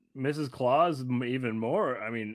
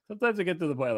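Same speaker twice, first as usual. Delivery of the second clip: ordinary delivery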